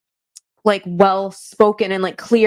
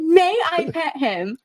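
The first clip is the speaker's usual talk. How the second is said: lightly voiced polite child, higher and slow; polite little kid, slower; 8 semitones higher, slower than usual. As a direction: savor each word like a sweet small boy. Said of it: "He said".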